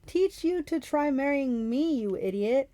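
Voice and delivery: high, drawn-out jeering; mocking high-pitched holler; taunting. A narrator's reading. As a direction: jeer at the monster, stretching it. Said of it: "Clarice taunted".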